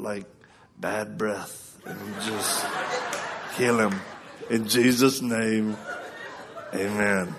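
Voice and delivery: dad's low, halting emergency prayer; imitating praying dad. Dad praying healing for his boy, imitated low and halting. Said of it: "how he lands the plane on an emergency prayer".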